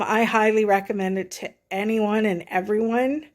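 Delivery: ordinary talk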